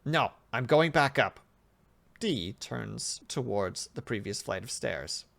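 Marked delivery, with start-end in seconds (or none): 0.0-1.4 defiant refusal. Acted. Subject refuses the order.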